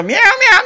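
high, thin imitated meow of a scared kitten. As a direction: meow like a cat stuck up a tree. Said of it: "like they do".

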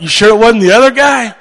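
high, loud, comic worried question; mock nervous plea. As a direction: squeak out a hopeful excuse for laughs. Acts own nervous reply, joking.

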